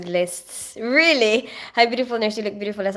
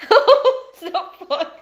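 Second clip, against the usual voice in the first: slow, laughing echo of the question; laughing repeat; 10 semitones higher, 8 dB louder, slower than usual. Real, not acted. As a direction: stretch it out through a laugh.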